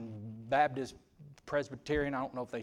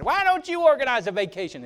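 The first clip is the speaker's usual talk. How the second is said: mock nagging wife; 11 semitones higher, 8 dB louder than usual. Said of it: "And she said it just like that".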